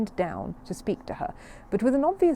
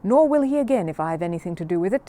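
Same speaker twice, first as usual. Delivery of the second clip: grim; 8 dB louder than usual. Acted. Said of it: "said Missus Bird grimly".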